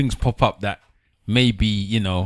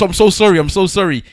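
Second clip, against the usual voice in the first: higher and louder, a gushing retold apology; flustered apology; 8 semitones higher, 6 dB louder than usual. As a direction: blurt the sorry out, pitched up in embarrassment. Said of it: "He goes".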